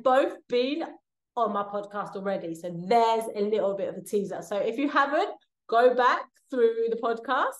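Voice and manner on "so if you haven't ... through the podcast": normal talking voice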